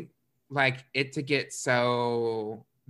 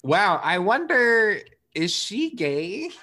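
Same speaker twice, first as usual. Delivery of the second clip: sarcastic wonder; 6 semitones higher, 5 dB louder, slower than usual. Retold or imitated. Sarcastic aside.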